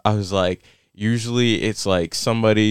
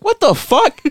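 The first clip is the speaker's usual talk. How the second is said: very high, laughing exclamation; laughing shock; slower than usual. Retold or imitated. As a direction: shriek it out between laughs.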